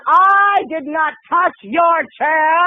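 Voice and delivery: high, slow, word-by-word denial; hammed-up indignation, every word leaned on; mock angry. Wrongly accused moviegoer to the woman in front, mock outrage. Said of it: "The anger started to build".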